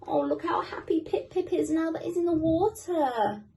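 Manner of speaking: put-on gentle read-aloud for kids; story narration. A narrator's reading. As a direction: read it like a bedtime picture book. Reading a picture book to children.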